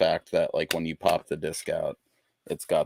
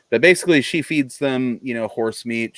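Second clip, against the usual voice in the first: loud, raised pitch easing down; real raised voice on a fresh start; yelling; 6 semitones higher, 7 dB louder than usual. Real, not acted. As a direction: burst in loudly, then settle. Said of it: "You don't have to yell at me".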